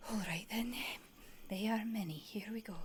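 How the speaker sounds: nervous whisper. Acted. Nervous driver steels herself.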